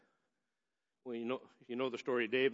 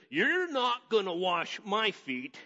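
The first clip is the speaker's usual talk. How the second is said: higher, slow, protesting Peter; Peter refusing; 8 semitones higher, 6 dB louder, much slower than usual. Retold or imitated. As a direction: refuse him outright, slowly. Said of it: "And Peter says".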